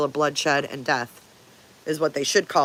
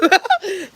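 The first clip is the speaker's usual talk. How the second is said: loud high laugh; high burst of laughter; 5 dB louder than usual. Real, not acted. Laughing at shirt slogan.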